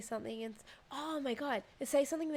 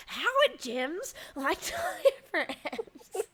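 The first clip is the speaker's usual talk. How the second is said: louder, slow, breaking into a laugh mid-question; loud insistent demand; 8 semitones higher, 7 dB louder, much slower than usual. Retold or imitated. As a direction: demand the answer while laughing. Shaking him awake, demanding.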